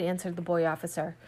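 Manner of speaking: ordinary delivery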